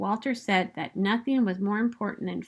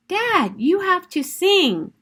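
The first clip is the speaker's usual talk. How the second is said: much higher, louder, slow urging; pleading Buddy; 9 semitones higher, 5 dB louder, slower than usual. Acted. Buddy urges his dad to sing, high pleading. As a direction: beg him to join in, drawn out.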